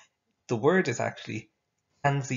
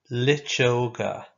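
slow, clear Irish word for the class; slow model word; 3 dB louder, slower than usual. Shown as a demonstration. Teacher modelling a new Irish word slowly. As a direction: drag each syllable out for them to copy. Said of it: "Now you say it after me".

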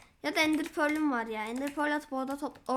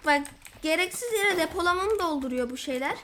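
ordinary talk

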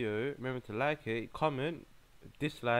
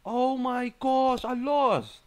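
loud, high, drawn-out wail of defeat; anguished shout; 13 semitones higher, 11 dB louder, much slower than usual. Real, not acted. Player who has just lost the match, anguished. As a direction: cry out slowly at full volume.

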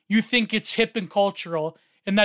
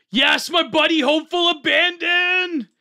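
excited cheer; 7 semitones higher, 5 dB louder than usual. Real, not acted. Streamer spotting a buddy's post, excited shouted cheer. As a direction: hail your buddy with a big shout. Hailing a friend's post on screen.

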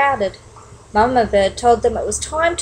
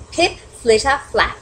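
usual speaking voice

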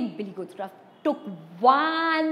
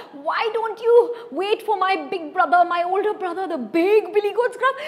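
high, fast, squeaky goat voice; little goat pleading; 6 semitones higher than usual. Acted. Medium goat talking the troll into waiting. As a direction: chatter fast to talk your way out.

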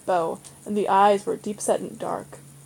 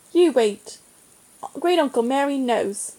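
a touch resentful, slightly higher; 4 semitones higher than usual. Acted. Boy answering his brother, mildly resentful. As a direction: defend him, mildly put out. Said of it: "He said resentfully".

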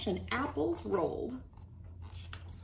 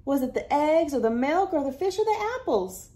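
higher, louder eager questions; eager list; 7 semitones higher, 4 dB louder than usual. Acted. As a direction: rattle off guesses. Hank lists eagerly.

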